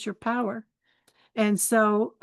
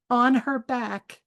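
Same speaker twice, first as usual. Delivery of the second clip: ordinary delivery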